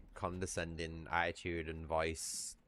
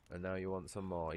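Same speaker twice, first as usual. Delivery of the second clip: ordinary talk, nothing marked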